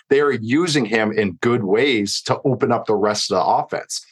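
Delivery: normal talking voice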